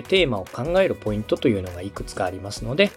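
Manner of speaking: normal talking voice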